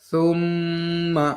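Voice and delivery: সুম্মা drawn out very slowly with a held doubled m; slow teaching recitation; slow Quranic recitation. Shown as a demonstration. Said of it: "নিচে আমরা কিছু example দিয়েছি".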